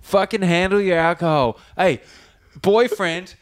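hammed-up annoyed heckle; mock telling-off. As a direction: play a fed-up passenger shouting them down, drawing words out. Imagined passenger telling the couple off.

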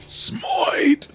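whispered shout. Real, not acted. Hype callout at the table.

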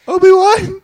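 very loud, high cry of a name; sudden shout. Acted. Bat holder overcome by crazy memories, sudden shout. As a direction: burst out yelling the name.

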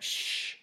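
soft, hushed "shh"; a quiet shush with finger to lips. A narrator's reading. Woken passenger going into neighbour's personal space, shushing. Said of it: "put my fingers to my lips".

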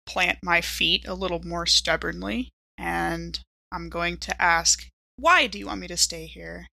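normal talking voice

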